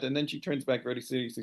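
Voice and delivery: ordinary delivery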